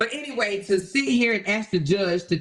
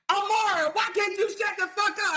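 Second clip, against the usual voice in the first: pitched-up fed-up judge voice; annoyed judge; 9 semitones higher than usual. Retold or imitated. Judge scolding Amara in court, pitched-up and fed up. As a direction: play the exasperated judge scolding. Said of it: "The judge would be like".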